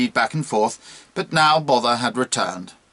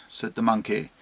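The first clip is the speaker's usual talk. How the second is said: ordinary delivery, nothing marked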